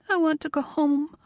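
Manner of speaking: high, plaintive woman's voice. Acted. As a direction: ask it wistfully, near the end of her rope. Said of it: "The plaintive note in her voice".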